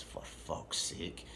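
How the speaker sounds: whispered, slow exasperated curse; slow annoyed whisper; muttered curse. Retold or imitated. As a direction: breathe it out under your breath, fed up. Annoyed muttered curse, son asleep.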